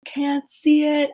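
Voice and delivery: normal talking voice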